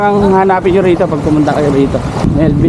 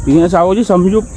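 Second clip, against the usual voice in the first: ordinary talk, nothing marked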